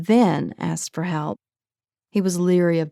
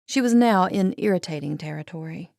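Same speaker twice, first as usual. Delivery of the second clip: usual speaking voice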